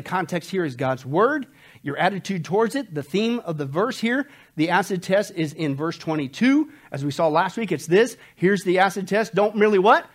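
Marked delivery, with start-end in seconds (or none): none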